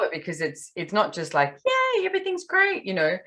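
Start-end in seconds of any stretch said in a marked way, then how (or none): 1.7-2.8 pitched way up, sing-song fake cheer; mock the forced everything-is-great vibe; mock cheerfulness. Mocking fake positivity at expo.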